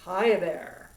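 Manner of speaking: low chest-voice hi there. Shown as a demonstration. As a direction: let it rumble low in the chest. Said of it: "Chest voice is my usual speaking voice".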